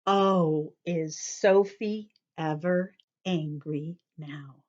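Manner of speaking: ordinary delivery, nothing marked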